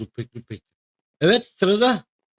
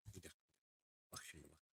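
hushed; 9 semitones lower, 37 dB quieter, faster than usual. Shown as a demonstration. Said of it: "Ne bak şimdi bak".

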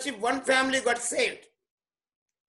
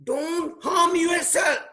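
slow, drawn-out shout, pitch raised; 5 semitones higher, much slower than usual. Shown as a demonstration. Preacher shows how Paul shouted at the jailer, slow ringing shout. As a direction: call out to stop him, slowly.

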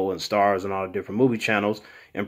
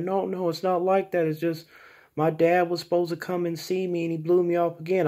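higher, thin voice as the upset friend; mock girlfriend voice; 8 semitones higher, 4 dB quieter than usual. [name] voices his friend explaining herself, in a higher put-on voice. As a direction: play the friend pleading, pitched up.

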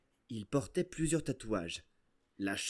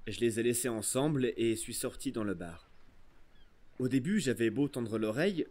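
usual speaking voice

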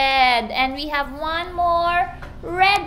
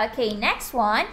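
normal talking voice

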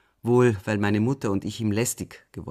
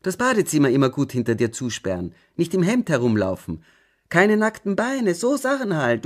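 loud, agitated scolding that climbs much higher; auffahrend; 7 semitones higher, 7 dB louder than usual. A narrator's reading. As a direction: rattle off the rules, getting more shrill and exasperated. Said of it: "fuhr sie auf". (aufgebracht schimpfend, immer höher und lauter)

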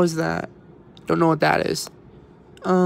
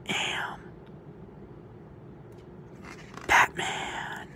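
dramatic whisper; 13 dB quieter than usual. Pretend. Dramatic Batman origin reveal.

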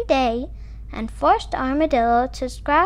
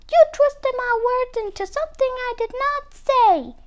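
high-pitched indignant shriek; 10 semitones higher than usual. A narrator's reading. Muskrat confronts Armadillo for twisting his words, shrieking. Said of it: "he shrieked".

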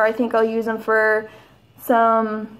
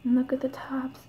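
awed whisper; 10 dB quieter than usual. Real, not acted. Awed by the tarot deck.

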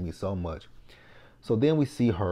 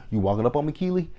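confrontational; 7 semitones higher, 5 dB louder, faster than usual. Retold or imitated. Confronting Keely.

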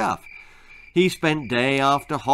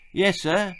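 panting; 7 semitones higher than usual. Acted.

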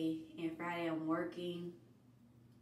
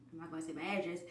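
normal talking voice

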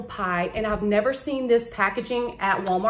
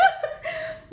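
high laugh. Real, not acted. Laughing at own remark.

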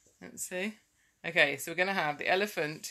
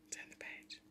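hushed whisper to a little helper; whispered aside; 23 dB quieter than usual. Real, not acted. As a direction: whisper it privately, under the story.

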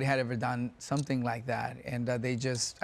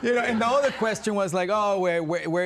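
Fez voice; 8 semitones higher, 5 dB louder, slower than usual. Acted. Slipping into Fez voice.

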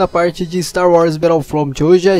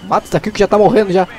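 usual speaking voice

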